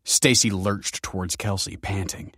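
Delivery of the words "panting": ordinary delivery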